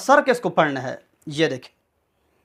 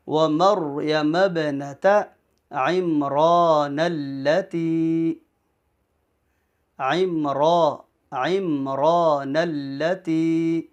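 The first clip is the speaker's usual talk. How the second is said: slow, measured Quran recitation; careful teaching recital, syllables drawn out; verse recitation; much slower than usual. Shown as a demonstration. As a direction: recite with long held vowels. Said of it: "یہ پوری آیت میں پڑھتا ہوں".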